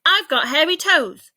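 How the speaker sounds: high, loud proud shout. Acted. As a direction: yell it out gleefully. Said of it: "he shouted back".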